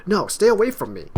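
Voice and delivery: high, loud, warding someone off; alarmed shout. Acted. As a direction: shout them back in alarm. Acted frightened rejection in quarrel.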